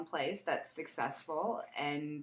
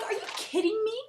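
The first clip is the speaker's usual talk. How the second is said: incredulous; 3 dB louder than usual. Retold or imitated. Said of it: "I was like".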